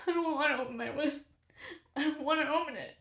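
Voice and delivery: laughing, whiny mock complaint; whiny protest. Retelling her reluctance to open the window, laughing whine. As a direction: pout it out, half laughing.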